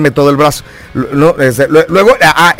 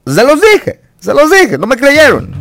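"Se los dije" sung out much higher; a gloating "se los dije", pitched way up; triumphant told-you-so; 10 semitones higher than usual. Shown as a demonstration. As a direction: crow "se los dije" up high like you called it. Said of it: "No les dije que".

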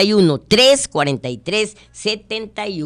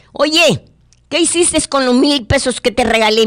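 grandpa's question; 7 semitones higher than usual. Acted.

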